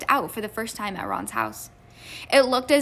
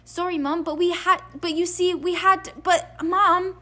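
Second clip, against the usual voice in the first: high-pitched, halting excuses; cowed pleading; 7 semitones higher than usual. A narrator's reading. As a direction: plead with an angry mother in a higher voice. Sons cowering before angry mother.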